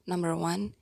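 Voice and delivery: ordinary talk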